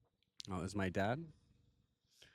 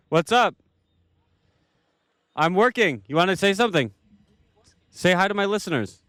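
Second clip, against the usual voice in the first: calling out to dad; 7 semitones higher, 6 dB louder than usual. Real, not acted.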